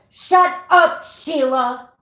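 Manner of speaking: high, loud mock yell of shut up; mock angry yell. Shown as a demonstration. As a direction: shout it at the imaginary Sheila, hammed-up. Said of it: "be in a room by yourself and go".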